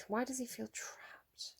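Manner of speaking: breathy whispered question, slow. Real, not acted. Tarot reader whispering a question to her cards. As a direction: hush it right down to a whisper.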